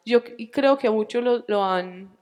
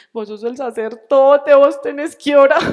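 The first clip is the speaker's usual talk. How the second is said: crying plea; 5 semitones higher, 10 dB louder than usual. Retold or imitated. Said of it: "llorando y le dije".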